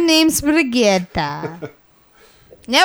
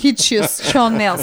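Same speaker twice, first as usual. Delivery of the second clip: put-on Russian-like accent; 4 semitones lower than usual. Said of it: "is that what they do in Russia?".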